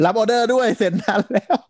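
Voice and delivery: high-pitched shop announcement through laughter; laughing mock call-out. Joking about calling restaurant customers over the speaker, high and laughing. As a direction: play at calling out orders, laughing as you go.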